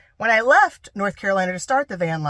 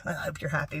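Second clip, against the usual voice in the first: low-volume mock bitterness; sarcastic; 3 semitones lower, 9 dB quieter than usual. Shown as a demonstration. Showing how not to say it to dad, quietly sarcastic. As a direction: say it as a muted dig. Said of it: "Or not like".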